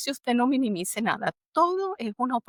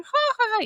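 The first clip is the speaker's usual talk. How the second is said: mocking laugh; 13 semitones higher, 3 dB louder, much faster than usual. Colleagues mocking her ambition.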